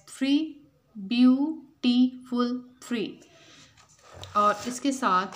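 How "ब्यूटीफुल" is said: "ब्यूटीफुल" in three loud, separate beats; syllable by syllable. Shown as a demonstration. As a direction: break "ब्यूटीफुल" into beau-ti-ful with gaps. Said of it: "divide the given word into their syllables". ("ब्यूटीफुल" को तीन हिस्सों में तोड़कर)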